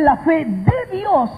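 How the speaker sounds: "de" punched high before "Dios"; stress on DE. Real, not acted. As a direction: lean hard on "de". Stressing DE against EN.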